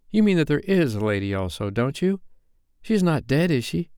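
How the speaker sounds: mildly crying out. A narrator's reading. First twin interrupting Wendy's story, mildly crying out. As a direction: pipe up with an eager question. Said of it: "cried the first twin".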